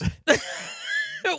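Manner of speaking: very high squeal of laughter. Real, not acted. Co-host shrieks with laughter at muff opinions.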